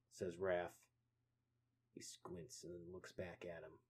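ordinary delivery

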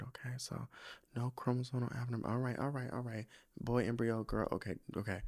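quiet murmured repeating to herself; mom mumbling it back. Retold or imitated. Mom softly murmuring the prayer list back. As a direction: mumble each item back softly as if noting it.

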